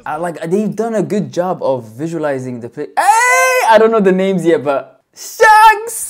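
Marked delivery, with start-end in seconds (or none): 3.0-6.1 high, loud cheer; real fan excitement; excited shout. Real, not acted. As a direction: hype it up for a hero's entrance. Cheering as Shanks appears.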